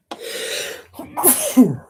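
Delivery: a loud real sneeze; sneezing. Real, not acted. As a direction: sneeze away from the mic. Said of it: "I am defo gonna sneeze again".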